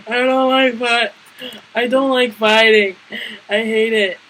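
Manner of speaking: mock tearful answer between sobs; imitated crying. Teller answering girls while crying, tearful imitation. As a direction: act out talking while crying. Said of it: "I was crying and answering them at the same time".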